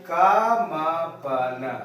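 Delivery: "का मा पा न" drawn out very slowly, each syllable apart. Shown as a demonstration. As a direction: spell out "का मा पा न" slowly for the class. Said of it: "तोड़ के समझते हैं".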